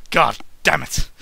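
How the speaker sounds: ordinary talk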